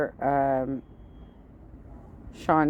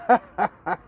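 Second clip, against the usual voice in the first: incredulous laugh; 4 semitones higher, 5 dB louder than usual. Real, not acted. Laughing at pepper.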